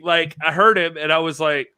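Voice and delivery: ordinary talk, nothing marked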